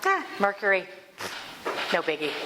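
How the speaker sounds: easygoing, as if nothing could faze you; breezy unbothered person. Acted. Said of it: "that person who was going".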